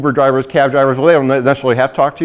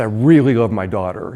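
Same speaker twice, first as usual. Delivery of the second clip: low deadpan retelling; dry humor; 6 semitones lower than usual. Retold or imitated. Recruiter jokes about loving his daughter, dry deadpan. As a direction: deliver it dryly, pitched well down. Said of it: "dry sense of humor".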